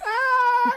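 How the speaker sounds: loud high frog squeal; high, piercing frog cry; imitated frog scream. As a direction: screech like a frog mid-swallow. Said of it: "it just sounds just like that".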